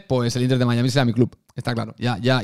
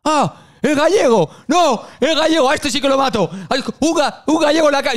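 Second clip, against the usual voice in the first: mocking Argentine fan; 11 semitones higher than usual. Fan mocking the Galician accent, jeering Argentine shout. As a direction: jeer at the Galician like a furious hincha. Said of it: "te vas a girar así".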